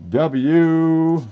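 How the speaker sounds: loud, high, long-held call; big drawn-out announcer shout. Real, not acted. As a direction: belt out the letter and hold it. Calling out the picked letter.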